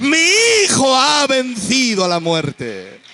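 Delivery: full-voiced Pentecostal climax; fiery preaching. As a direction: cry it out to the congregation.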